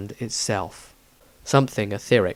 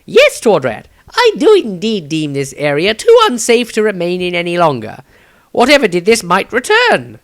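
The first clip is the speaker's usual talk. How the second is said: higher, louder formal wizard voice; polite wizard; 7 semitones higher, 9 dB louder than usual. A narrator's reading. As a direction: speak with strained politeness. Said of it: "smiled politely".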